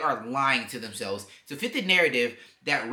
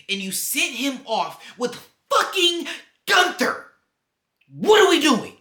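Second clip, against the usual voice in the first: high, loud, exasperated yelling; real fed-up outburst, voice shooting up; outraged rant; 11 semitones higher, 9 dB louder than usual. Real, not acted. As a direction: build to a shouted, disbelieving protest. Outraged at who beat his hero.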